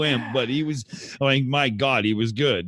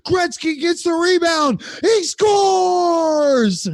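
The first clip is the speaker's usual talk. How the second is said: high, excited goal call; excited hockey play-by-play; 13 semitones higher, 3 dB louder, much slower than usual. Acted. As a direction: shout it like a hockey announcer calling a goal. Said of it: "maybe it's a hockey joke".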